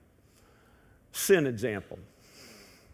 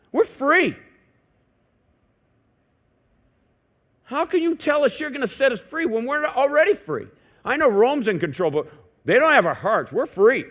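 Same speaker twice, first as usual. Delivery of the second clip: higher, louder, proud declaration; defiant pride; 7 semitones higher, 3 dB louder than usual. Retold or imitated. Crowd declaring to Jesus they are free, higher and proud. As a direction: proclaim your freedom against Rome. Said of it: "They answered him".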